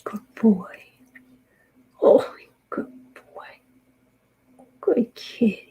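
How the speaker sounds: slow whisper to the cat; whispered praise. Real, not acted.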